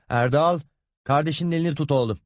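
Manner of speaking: tiz, hızlı çocuğa sesleniş; oğluna uzaktan seslen, adını uzat; seslenme. A narrator's reading. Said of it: "diye seslendi". (anne, oğluna seslenerek)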